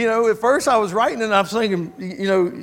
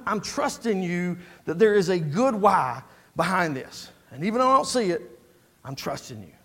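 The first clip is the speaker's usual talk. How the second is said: ordinary talk, nothing marked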